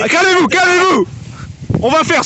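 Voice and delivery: high loud call for calm; host yelling to stop the fight; shouting to calm. Real, not acted. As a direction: shout over the brawl.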